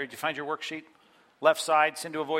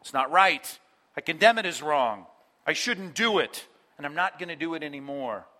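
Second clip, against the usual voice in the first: loud, earnest vow; firm resolve; 5 dB louder than usual. Retold or imitated. Pastor voices a repentant spouse, loud and firm. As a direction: declare it wrong with conviction. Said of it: "repentance means".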